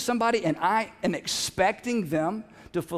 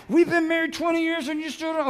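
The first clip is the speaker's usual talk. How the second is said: mock angry wife; 8 semitones higher than usual. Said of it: "She may get mad".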